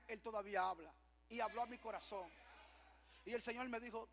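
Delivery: ordinary talk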